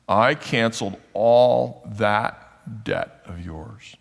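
"all that debt" hit word by word, very slowly; very emphatic. Retold or imitated. King rebuking the servant he forgave, very emphatic and slow. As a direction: draw out "all" and "that", a weighty pause before "debt". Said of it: "Very emphatic in the original".

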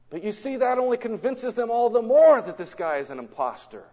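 ordinary talk, nothing marked

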